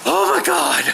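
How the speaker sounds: high, loud cry of shock; shocked exclamation. Real, not acted. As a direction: blurt it out at the cold. Stepping out into cold.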